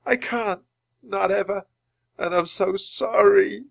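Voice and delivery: high-pitched, tearful confession; weeping. Acted. Teddy blames himself for harming Penny, weeping. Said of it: "As Teddy wept".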